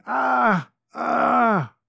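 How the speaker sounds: high, drawn-out groans of pain; crying from pain. Acted. Innkeeper moans in pain before the noblewoman. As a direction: moan like a broken man. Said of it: "he's crying from pain".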